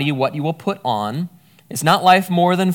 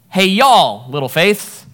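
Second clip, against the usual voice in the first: "Hey, y'all" called out louder, then easing; louder vocative call to a group; 4 semitones higher, 6 dB louder, slower than usual. Shown as a demonstration. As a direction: hail a whole crowd at once. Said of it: "That's the oi at the end".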